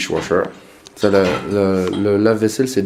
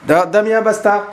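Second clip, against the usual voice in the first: scolding a pet; 9 semitones higher than usual. Real, not acted. Scolding the dog.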